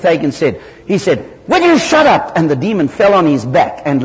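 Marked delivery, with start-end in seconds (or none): 1.5-2.4 much higher and louder, fed up; exasperated shout. Retold or imitated. Hagin loses patience with the demon and yells, fed up. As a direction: snap at him to shut up. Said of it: "in his frustration and exasperation".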